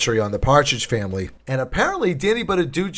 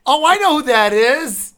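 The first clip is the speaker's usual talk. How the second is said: realisation; 11 semitones higher, 4 dB louder than usual. Retold or imitated. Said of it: "and I'm like".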